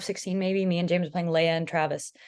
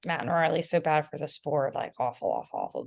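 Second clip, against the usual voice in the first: mocking online haters; 3 semitones lower than usual. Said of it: "they're all like".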